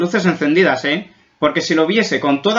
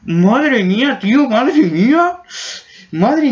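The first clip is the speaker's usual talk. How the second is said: spooked; 7 semitones higher, much slower than usual. Real, not acted. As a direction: sound genuinely creeped out. Scared reaction to creepy video. (asustado de verdad, agudo y fuerte)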